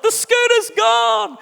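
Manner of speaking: put-on high, slow, loud wailing child; crying child. Son tells dad the scooter is gone, high loud wail. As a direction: wail it out like a heartbroken little boy. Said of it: "my son Zeke's crying".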